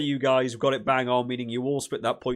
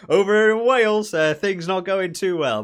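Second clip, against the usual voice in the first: mock pity; 5 semitones higher, 8 dB louder than usual. Real, not acted.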